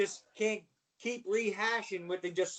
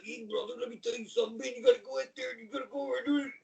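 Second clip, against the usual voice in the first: high, strained wrestler promo voice; Randy Savage; 6 semitones higher, much faster than usual. Retold or imitated. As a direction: rattle it off fast as a pumped-up wrestler. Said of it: "He really talked like that".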